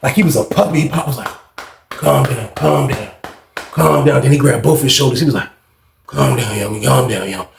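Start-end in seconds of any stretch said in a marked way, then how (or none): 1.9-4.2 lower-pitched, imitated soothing; soothing calm-down. Acts Pop soothing him. 6.1-7.5 lower-pitched, imitated soothing; soothing calm-down. Acts Pop soothing him.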